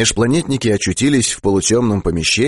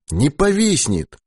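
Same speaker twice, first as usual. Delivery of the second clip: mildly assured, higher and drawn out; slow, higher-pitched firm denial; confident; 7 semitones higher, slower than usual. A narrator's reading. Krutikov reassures others about the pilot, confidently. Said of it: "уверенно сказал Крутиков".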